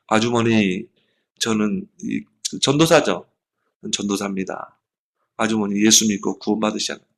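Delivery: a soft, low invitation to faith; gentle plea. Retold or imitated. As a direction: lean in and plead quietly. Gently urging the shoe-shop woman.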